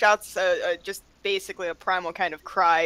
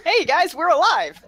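high, loud victory announcement; excited call-out; 9 semitones higher, 6 dB louder than usual. Pretend. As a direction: call out to the whole party, pitched up. Mock cheer after battle is won.

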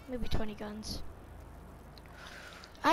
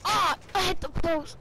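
pained yelp; 6 semitones higher, 4 dB louder, slower than usual. Pretend. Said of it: "I hit head first into the pole".

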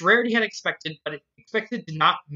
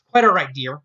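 hurriedly; 2 semitones lower, 8 dB louder than usual. Acted. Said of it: "Rarity said hurriedly".

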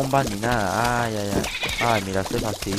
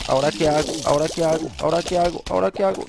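repeated question climbing steadily in pitch; flustered, pitch creeping up to high; rising panic; 4 semitones higher, 4 dB louder, faster than usual. Real, not acted. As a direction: get more frantic with every repeat, higher each time. Panics, not knowing what to do.

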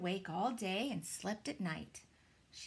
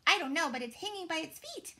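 fast, high, loud chirping bird voice; chirpy; 9 semitones higher, 6 dB louder, faster than usual. A narrator's reading. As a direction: chatter like a little bird. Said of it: "chirped Flitter".

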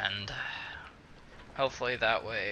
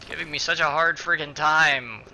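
frustrated complaint; 5 semitones higher, 6 dB louder than usual. Real, not acted. Frustrated at characters.

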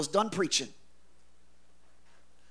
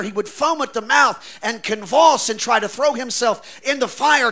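louder, higher, slowed pleading; distraught father; 5 semitones higher, 7 dB louder than usual. Acted. A distraught father pleads with Jesus for his son, crying out. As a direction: beg as a desperate father.

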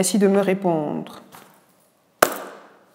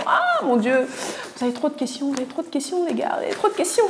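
higher, playful groan of too many questions; amused mock exasperation; 8 semitones higher, faster than usual. Real, not acted. Doctor teasing viewers about their many questions, amused mock exasperation. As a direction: tease the viewers with fond exasperation.

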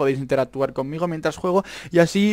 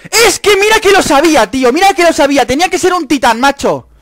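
loud, high indignant complaint; high-pitched gamer outrage; exasperated outburst; 11 semitones higher, 10 dB louder than usual. Real, not acted. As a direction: cry out in frustrated protest. Outraged at a death in game.

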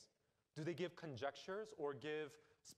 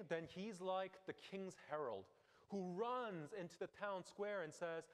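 normal talking voice

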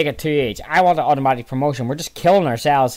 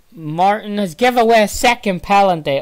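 high, raised-voice disbelief; exasperated live commentary; dismayed outburst; 7 semitones higher, 4 dB louder than usual. Real, not acted. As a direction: exclaim in dismay at the blunder. Dismay at conceding second penalty.

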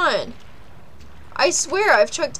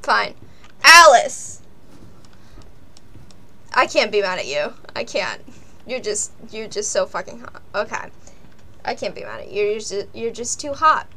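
anger melting away; 7 semitones lower than usual. Real, not acted. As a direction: let the anger drain into fondness. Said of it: "anger melting away".

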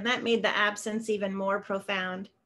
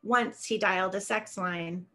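ordinary delivery

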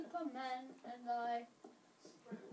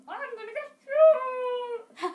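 shrill, loud, slow yell; little sister yell; 9 semitones higher, 14 dB louder, much slower than usual. Retold or imitated. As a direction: scream it like a little sister chasing. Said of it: "My sister's gonna go".

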